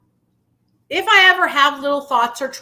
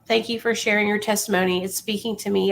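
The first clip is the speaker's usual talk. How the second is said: normal talking voice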